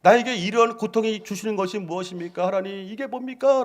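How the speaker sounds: retold anguished prayer to God; lamenting prayer. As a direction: cry out to God in complaint. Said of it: "막 한탄하고 기도하고".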